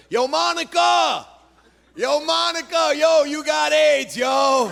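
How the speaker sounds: high, slow holler across the platform; screaming across tracks. Retold or imitated. Subway guy screaming across the tracks at Monica, high slow holler. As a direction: yell it to Monica on the far side. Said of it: "This guy screams across the tracks".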